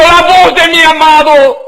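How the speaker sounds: high, loud cry of delight; rapturous exclamation of the beloved's voice; joyful exclamation. Acted. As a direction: burst out with joy. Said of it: "Con gozo diciendo".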